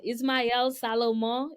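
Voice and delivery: name said slowly in a Haitian accent. Shown as a demonstration. Recruiter showing how her name is said, Haitian accent. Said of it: "say the name with the accent".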